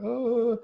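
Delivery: very high crying oh; child's sob. Retold or imitated. Little sister cries as she comes in, very high. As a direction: mimic a little girl's whimper. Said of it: "she's like".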